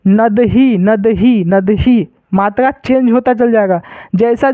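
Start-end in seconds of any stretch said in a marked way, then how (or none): 0.0-2.1 "नदही" repeated with a clear final hee; visarga echoing vowel. Shown as a demonstration. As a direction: drill "नदही", each ending on an aspirated hee. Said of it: "इसको क्या पढ़ेंगे". ("नदही" के अंत में साफ़ ही)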